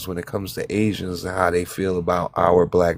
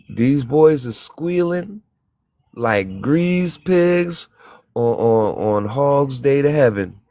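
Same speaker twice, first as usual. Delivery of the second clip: mocking; 5 semitones higher, slower than usual. Mocking the companies.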